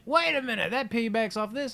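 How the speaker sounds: high-pitched retold eureka moment; sudden realisation. Remembering a related story, high realising tone.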